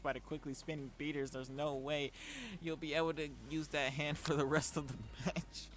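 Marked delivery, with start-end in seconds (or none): none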